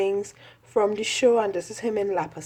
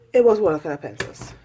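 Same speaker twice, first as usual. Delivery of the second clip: ordinary talk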